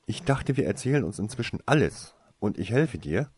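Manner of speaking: high, loud and hurt; indignant reproach. A narrator's reading. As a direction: keep scolding him, betrayed. Winona angrily reproaches him.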